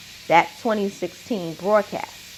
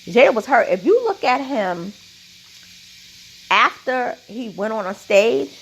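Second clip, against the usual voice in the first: ordinary talk, nothing marked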